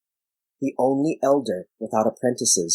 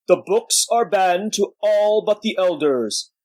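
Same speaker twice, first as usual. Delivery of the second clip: put-on slow, higher, mournful old giant; sad giant; 7 semitones higher than usual. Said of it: "He spoke like a sad giant".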